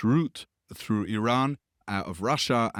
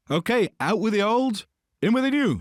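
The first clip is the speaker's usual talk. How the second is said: mock announcement; 12 semitones higher, 5 dB louder than usual. British and Soviets quoted toppling shah.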